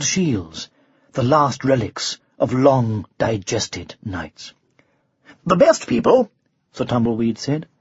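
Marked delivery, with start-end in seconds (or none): 5.4-6.3 high, light echo of the boast; airy knightly retort; breezy knight. A narrator's reading. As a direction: repeat it high and quick. Knight echoes dragon in own voice.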